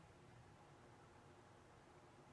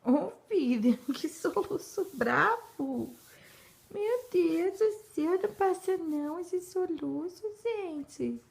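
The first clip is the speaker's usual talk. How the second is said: laughing; 7 semitones higher, 5 dB louder than usual. Real, not acted. Mother amused by child's hiccups.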